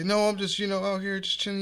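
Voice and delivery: high-pitched retold meek reply; re-enacted harmless, placating answer; meek excuse. Acted meek answer to gang threat.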